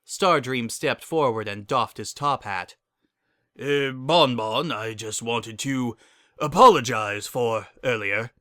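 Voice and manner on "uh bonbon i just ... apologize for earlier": slow, halting apology; nervous stallion stammering an apology. Acted. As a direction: stumble awkwardly through it. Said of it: "he stammered".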